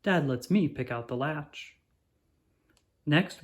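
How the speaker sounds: plain reading voice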